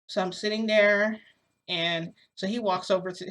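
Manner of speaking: usual speaking voice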